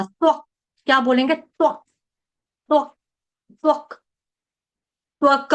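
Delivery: त्वक ending on a clipped half क; doubled k. Shown as a demonstration. Teacher drills the half क in त्वक. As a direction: close त्वक on a short stopped क. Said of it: "आधे क का आघात आएगा".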